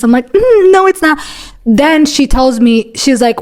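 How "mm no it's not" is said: chirpy mock-sweet refusal; passive-aggressive. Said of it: "the little passive aggressiveness".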